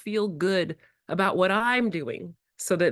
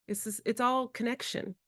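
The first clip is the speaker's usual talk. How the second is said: normal talking voice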